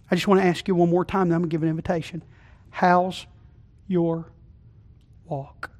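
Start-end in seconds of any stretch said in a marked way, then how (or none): 2.7-5.7 softer, slow, word by word; quiet searching question. Acted. Preacher asking the congregation quietly before the invitation. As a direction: let each word hang.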